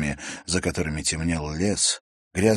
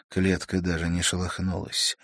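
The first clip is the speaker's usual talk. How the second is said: usual speaking voice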